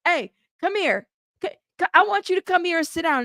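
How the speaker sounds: lightly voiced school office caller; mock the teacher summoning a kid; school staff calling. Teacher calling a student into the office, lightly imitated. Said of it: "when they would call you in the office".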